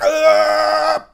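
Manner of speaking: loud, high-pitched cry of pain, imitated; mock shocked yelp. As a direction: yelp as if zapped, hammed up. Imitates man shocked by collar.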